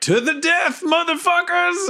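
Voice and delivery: very high-pitched war whoop; rally the troops with a hollered charge; hammed-up high battle yell; battle cry. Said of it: "and I'm like".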